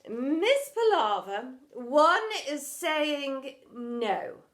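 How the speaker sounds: loud, very slow firm no; firm refusal. Acted. Headmistress refusing the drama teacher, loud and slow. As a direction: lay down the law slowly.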